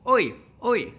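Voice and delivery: loud high oi calls; loud high rude hail. Retold or imitated. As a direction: shout oi at a stranger. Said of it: "don't go up to someone and say".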